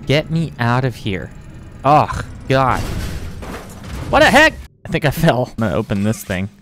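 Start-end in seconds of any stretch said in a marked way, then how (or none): none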